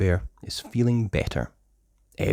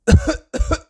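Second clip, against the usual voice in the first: high cough; 6 dB louder than usual. Acted. Adults coughing.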